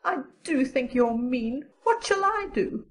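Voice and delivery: high, plaintive friend's voice; near-tearful Diana. A narrator's reading. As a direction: plead as if ready to cry. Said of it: "looked as if she were ready to cry".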